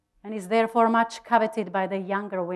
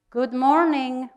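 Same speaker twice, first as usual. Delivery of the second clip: eager greeting in unison; 5 semitones higher, 6 dB louder than usual. Acted. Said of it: "we said in unison".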